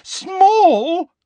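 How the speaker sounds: very high, loud incredulous shriek; loud, drawn-out rising disbelief; outraged disbelief. A narrator's reading. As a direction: blurt it shrilly, rising. Incredulous echo, second louder take.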